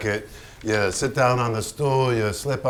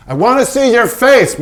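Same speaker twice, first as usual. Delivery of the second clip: much higher and louder; exasperated filmmaker shouting at his subject; frustrated demand; 11 semitones higher, 10 dB louder than usual. Acted. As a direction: insist angrily from behind the camera.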